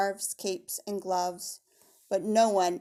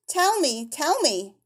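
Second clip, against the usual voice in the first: put-on high, playful child voice; teasing little girl; 6 semitones higher, 6 dB louder, slower than usual. A narrator's reading. Pearl teases her mother, high and playful. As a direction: chant it like a mischievous child. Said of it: "repeated Pearl, no longer serious".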